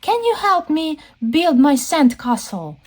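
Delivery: put-on high, childlike asking voice; Baby Shark. Baby Shark asks grandma for help, childlike voice. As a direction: ask sweetly up high like a small child. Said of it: "Baby Shark says".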